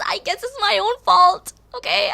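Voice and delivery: amused mock confession; laughing. Real, not acted. Laughing self-blame.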